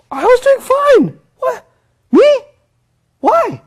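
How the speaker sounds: very high, squeaky mock protest; hammed-up disbelief, voice shot way up; shocked student. Student protests a failing grade, mock shocked.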